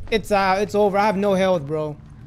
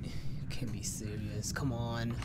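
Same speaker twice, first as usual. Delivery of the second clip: frustrated whisper; 14 semitones lower, 17 dB quieter, much slower than usual. Real, not acted.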